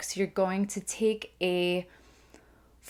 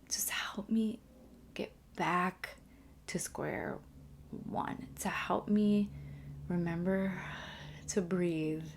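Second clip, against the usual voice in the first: calm grounding exercise; 7 dB quieter, slower than usual. Real, not acted. Closing a calming grounding exercise.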